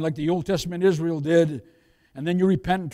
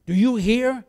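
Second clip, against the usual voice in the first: ordinary delivery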